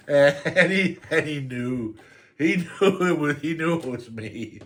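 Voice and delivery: high voice, fighting real laughter; real giggles breaking up the words; laughing. Real, not acted. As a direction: keep cracking up as you tell it. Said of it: "I shouldn't be laughing".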